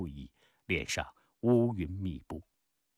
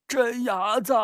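high, loud, sustained hail; calling out; 13 semitones higher, 5 dB louder than usual. Acted. Old playmate hails Peng on his homecoming, calling out. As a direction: holler a childhood nickname.

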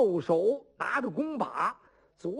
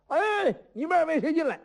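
calling out; 8 semitones higher than usual. Acted. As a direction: call the two men in across the crowd. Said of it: "在那招呼".

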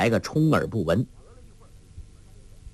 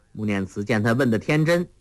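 ordinary delivery, nothing marked

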